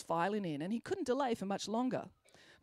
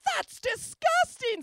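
very high, slow disgusted voice; disgusted teacher; 7 dB louder, much slower than usual. A narrator's reading. Teacher reacts to the poop, high and slow. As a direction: recoil from the bag. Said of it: "said Mister Spencer".

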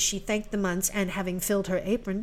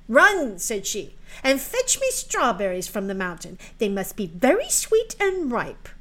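bossy stepsister; 4 dB louder than usual. Acted. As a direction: order her about sharply. Stepsister orders girl.

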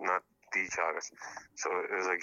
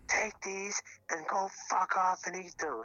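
high, slow imitation of an angry order; retold mad partner; 11 semitones higher than usual. Caller mimics partner shoving chocolates at him, mock furious. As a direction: drag out the furious dismissal, pitched way up. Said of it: "I can't believe how mad she is".

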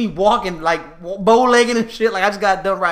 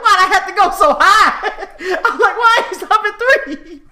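put-on panicked laughing kid; 11 semitones higher, 3 dB louder than usual.

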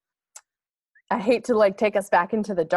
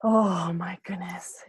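awed whisper; 4 dB quieter, slower than usual. Real, not acted. Awed reaction.

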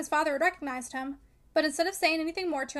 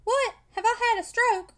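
high, loud, drawn-out anxious question; 7 semitones higher, 6 dB louder, slower than usual. A narrator's reading. Sick man asking the relatives what happened to him, anxious. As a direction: ask slowly in rising alarm. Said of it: "said Mister Tulliver anxiously".

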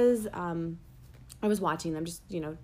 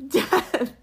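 parents laughing; 5 semitones higher, 5 dB louder than usual. Retold or imitated. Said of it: "they were even like".

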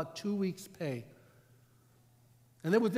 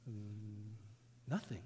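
sheepish low answer; 8 semitones lower, 14 dB quieter, much slower than usual. Acted. Criminal answers, low and soft.

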